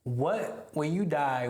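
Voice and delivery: normal talking voice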